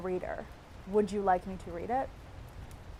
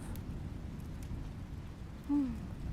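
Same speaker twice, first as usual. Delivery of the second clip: wistful sigh; 5 semitones higher, 9 dB quieter than usual. Real, not acted. Dreamy sigh about Nebraska.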